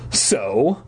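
ordinary delivery

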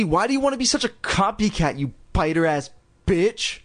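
high-pitched, heated sibling taunt. A narrator's reading. Girl accusing her copycat sister, heated. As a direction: accuse hotly, pitch jumping up. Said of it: "Lindy said heatedly".

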